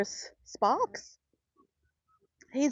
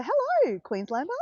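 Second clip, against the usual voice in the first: high, sing-out hello; swooping high-pitched hello; excited greeting; 6 semitones higher, 3 dB louder than usual. Real, not acted. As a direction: hail a fellow local with delight. Greeting a newly found fellow Queenslander.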